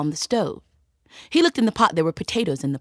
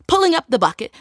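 ordinary delivery

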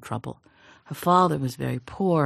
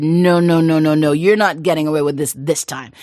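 much louder, hammering the repeated no; angry refusal; 7 dB louder than usual. Acted. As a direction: shut her down with loud refusals. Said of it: "He was so angry".

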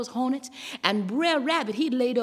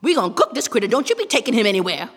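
loud, scolding fox; Brer Fox; 7 dB louder, faster than usual. A narrator's reading. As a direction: lay down the law loudly. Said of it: "Brer Fox says".

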